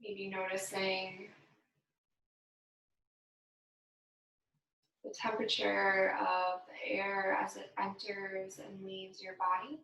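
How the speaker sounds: unhurried, gentle breathing guidance; guided meditation. Acted. Yoga instructor guiding the class through breathing, calm meditation voice. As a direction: lead the class calmly through each breath.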